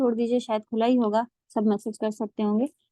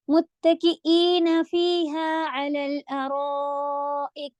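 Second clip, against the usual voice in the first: slow Quranic recitation; 3 semitones higher, much slower than usual. Shown as a demonstration. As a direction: recite slowly and evenly. Models the verse recitation. (آہستہ تلاوت)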